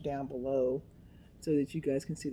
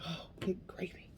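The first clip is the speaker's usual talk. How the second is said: breathy half-whisper; whispered good gravy of relief; hushed exasperation; 8 dB quieter than usual. Retold or imitated. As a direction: mutter it under your breath. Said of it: "I'm like".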